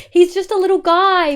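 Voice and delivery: high, loud pet voice; cooing pet talk. Real, not acted. Streamer doting on her cat to chat, in high cooing pet talk. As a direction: dote on the little cat.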